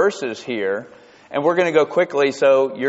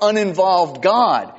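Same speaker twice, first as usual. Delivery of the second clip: ordinary talk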